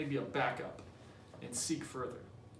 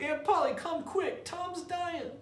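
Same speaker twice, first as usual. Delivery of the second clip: much higher, frantic call down the stairs; panicked boy calling; slower than usual. Acted. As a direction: shout for the aunt in a high panic. Sid calling downstairs in panic.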